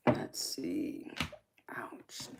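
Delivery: unvoiced whisper to herself; whispered aside. Real, not acted. Streamer checking her phone mutters it in a whisper. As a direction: breathe it under your breath.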